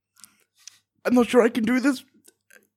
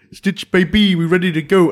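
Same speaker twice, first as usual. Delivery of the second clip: cocky call out; 4 semitones higher, 9 dB louder than usual. Acted.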